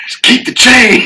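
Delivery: high, drawn-out imitated cry; mock one-liner. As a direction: play it as a high, stretched movie line. Listener re-enacts the movie-like line.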